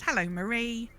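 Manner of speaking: ordinary talk, nothing marked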